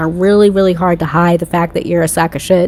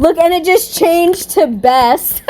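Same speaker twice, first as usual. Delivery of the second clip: high, slow amazed exclaim; surprised; 9 semitones higher, slower than usual. Real, not acted. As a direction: gasp it out, amazed at the screen. Surprised at radar change.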